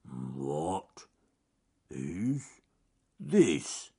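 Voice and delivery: halting bear voice, rising at the end; puzzled mumble. Acted. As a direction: mumble it one word at a time. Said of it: "he mumbled".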